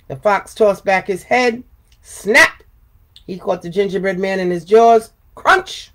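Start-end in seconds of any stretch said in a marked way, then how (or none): 2.0-2.6 sharp snap effect. Acted. Fox snaps jaws. 5.3-5.9 high crunch effect. Acted. Fox bites cookie.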